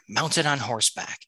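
usual speaking voice

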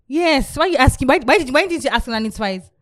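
usual speaking voice

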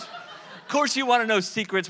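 ordinary delivery

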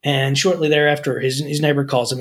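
ordinary talk, nothing marked

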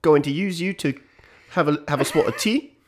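high, loud hammed-up British ruffian accent; mock British accent. Mocking the British as an American, in a high hammed-up British accent. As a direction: toffish British chatter pushed way up in pitch. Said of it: "that's how the ruffians say it".

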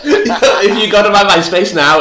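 loud laughing burst. Real, not acted. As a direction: laugh into the line. Laughing, louder.

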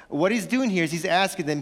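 ordinary delivery

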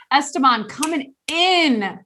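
usual speaking voice

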